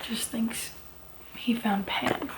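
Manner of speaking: whisper. Real, not acted. Conspiratorial plot remark.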